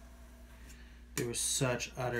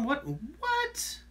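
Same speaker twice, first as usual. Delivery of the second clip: surprised. Real, not acted. Reacting to chat in disbelief.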